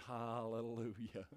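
slow, low, very soft hallelujah; drawn-out, understated praise, almost to himself; hushed hallelujah. Retold or imitated. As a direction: breathe out a quiet, awed hallelujah. Retells his answer to the letter.

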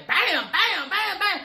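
high mock bang noises; playful smashing sound effects; imitated banging. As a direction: punch out each bam like a hammer hit.

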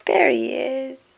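high, drawn-out singsong reveal; delighted discovery. A narrator's reading. Reader finding Corduroy among the costumes, delighted. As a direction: sing it out, stretched and pleased.

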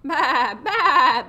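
loud, wavering baas; sheep bleating. Acted. Storyteller voicing Sheep catching out Turkey, loud bleat. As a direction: bleat twice, loud with a quick wobble. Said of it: "bleated Sheep".

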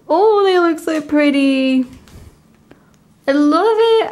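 real thrilled outburst, rising high; delighted gushing. Real, not acted. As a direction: burst out with love for it. Gushing over album photos.

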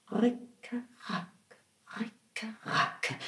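soft, breathy, rhythmic clatter; whispered mill sound. A narrator's reading. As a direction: whisper the grinding like a far-off mill. Mill grinding sound effect.

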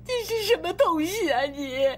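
crying accusation. Acted. Wife berating her unfaithful husband, crying bitterly. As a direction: sob out the accusations. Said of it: "别哭了".

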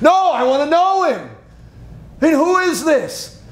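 freed slave eagerly begging servants to know his rescuer; eager plea. Acted. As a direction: beg to know who saved you.

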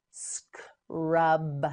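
"Scrub" split into s, k, long rub; slow sounding-out. Shown as a demonstration. Teacher models word in parts.

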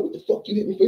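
high indignant cry; outraged New York. Retold or imitated. New York cries out high at Billy for hitting him. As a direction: pitch it up, stunned and angry.

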